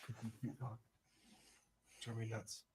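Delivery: very soft fond murmur; murmured doting. Real, not acted. Owner murmuring affection to his dog.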